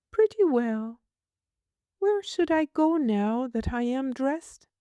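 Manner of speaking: put-on high, prim little girl asking. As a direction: voice a fussy small girl, pitched high. Child voice.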